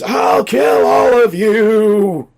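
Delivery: drawn-out, high, loud scream; screamed villain threat. Retold or imitated. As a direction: scream it slowly at full volume, imitating the villain. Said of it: "And then screams".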